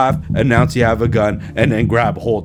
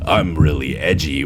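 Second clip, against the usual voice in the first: mock edgy guy; 9 semitones lower than usual. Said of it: "everything that's like".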